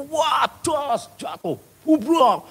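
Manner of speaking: high, shouted crash re-enactment; dramatic crash imitation. Storyteller re-enacting the coworker's motorbike fall, dramatic imitation. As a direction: act out the smash, high and drawn out.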